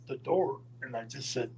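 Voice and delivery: ordinary delivery, nothing marked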